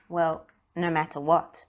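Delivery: plain reading voice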